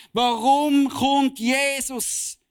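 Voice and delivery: drawn-out question. Real, not acted. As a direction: stretch every word to make them think. Rhetorical question stretched out. (langsam gedehnte, laute Frage)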